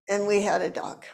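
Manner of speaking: ordinary delivery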